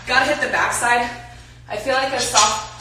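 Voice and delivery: ordinary talk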